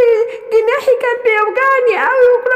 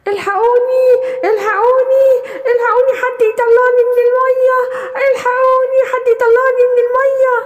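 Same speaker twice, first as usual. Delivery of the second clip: calling for help. Acted. Gazelle stuck in the water shouting and crying for help. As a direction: yell for rescue, panicked.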